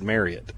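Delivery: Marriott rhyming with chariot; official pronunciation. Shown as a demonstration. Co-host correcting the host on the hotel name, Marriott said like chariot. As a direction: soften the end of Marriott to "ee-ut". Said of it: "they actually want you to pronounce it".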